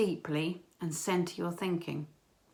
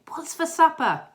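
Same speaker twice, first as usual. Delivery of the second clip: loud, high imitated child's question; kids' eager demand; 9 semitones higher, 7 dB louder than usual. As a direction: pipe up like a hungry boy. Boys' demand after the hug.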